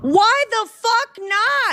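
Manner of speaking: high, drawn-out indignant retort; outraged outburst. Real, not acted. Reactor answering the government's lawyer, outraged. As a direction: fire back, stretching the words in disbelief.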